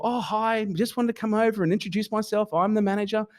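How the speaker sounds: high, put-on hostess politeness; sweet manager act. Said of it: "she starts at this beautiful".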